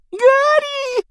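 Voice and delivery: very high, loud wail; Pettigrew moaning. A narrator's reading. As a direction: moan the name in grovelling gratitude. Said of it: "простонал Петтигрю". (тонкий протяжный стон)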